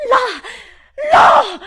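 high, loud piercing cry; shouted pointing. Acted. Governess showing Miles the window, shouted. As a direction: scream it, pointing. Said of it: "dissi a Miles".

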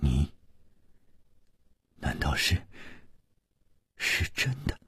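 hushed, halting disbelief; low, very soft shocked stammer; astonished. Acted. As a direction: barely voice the question, stunned. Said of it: "吃惊地望着他说".

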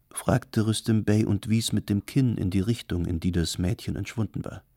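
ordinary delivery, nothing marked

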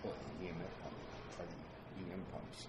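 very quiet, low question away from the mic; distant, hushed side question; off-mic aside. Real, not acted. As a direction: ask it off mic, barely picked up. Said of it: "off, off mic discussion".

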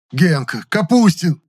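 loud, high, delighted shout; joyful howl. A narrator's reading. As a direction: howl the names with glee. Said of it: "радостно взвыл он". (радостный вопль)

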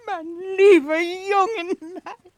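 high, tearful and overjoyed; tearful joyful welcome. Acted. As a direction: cry out in happy tears at the boy's return. Peggotty greets long-lost boy.

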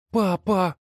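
put-on high child's anxious call. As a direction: call to father in alarm. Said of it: "с тревогой позвал Саул". (встревоженный детский оклик)

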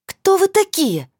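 high, loud, slow angry yell. Acted. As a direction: yell it, furious. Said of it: "проорала она сердито".

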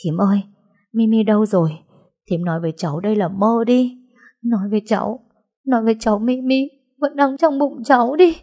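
grieving niece begging her aunt; crying plea. Acted. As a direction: plead through tears to the aunt. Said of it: "bật khóc ngon lành".

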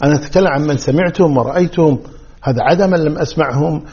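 ordinary talk, nothing marked

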